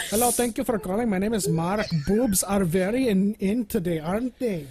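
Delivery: T-Mobile agent, put-on Indian accent. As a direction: play a chirpy helpline worker with an Indian accent. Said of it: "laughing at Indian accents".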